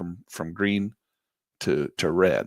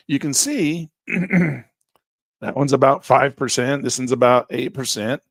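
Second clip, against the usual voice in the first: hoarse voice giving out; strained, hoarse and cracking; losing voice; 4 semitones higher, 5 dB louder, slower than usual. Real, not acted. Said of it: "Sorry, I'm losing my voice".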